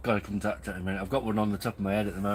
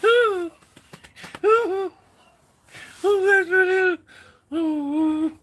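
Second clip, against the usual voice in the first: high, loud gasping oh's through laughter; laughing fit; 8 dB louder, much slower than usual. Real, not acted. Vlogger wheezing oh's to viewers after the joke, still laughing. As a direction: gasp for air between laughs.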